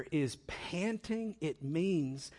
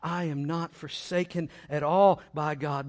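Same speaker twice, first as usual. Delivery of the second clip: usual speaking voice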